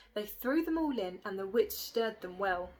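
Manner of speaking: usual speaking voice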